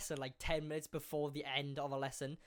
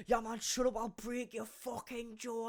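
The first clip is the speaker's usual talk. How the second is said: higher put-on tough voice, slowing into the threat; mock threatening kid; 5 semitones higher than usual. Kid threatens his friend in a retold row, mock menace. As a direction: draw out the threat in a higher mimicking voice.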